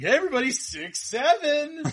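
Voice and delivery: high, bright hammed-up teen slang; a dad faking youth slang to annoy; middle-aged guy doing a cringe kid greeting; mock cool kid. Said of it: "run into the classroom ... be like".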